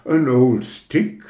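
put-on "An old stick" langsam mit englischem Akzent; englischer Akzent. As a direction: sprich "An old stick" wie ein Engländer, langsam. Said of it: "sagte die Rakete nun ganz fremdländisch". ("An old stick" mit englischem Akzent, langsam)

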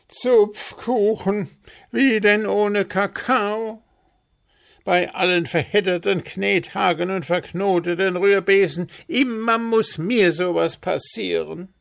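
loud, high-pitched wail of dismay; horrified howl. A narrator's reading. Puh discovering he has no cocoa, horrified howl. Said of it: "als Puh entsetzt aufheulte".

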